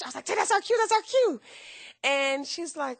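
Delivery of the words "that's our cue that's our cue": high, louder re-enacted urging; excited repeated cue; retold excited urging. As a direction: act out an eager nudge to a friend, pitched way up. Urging companion onto the carpet.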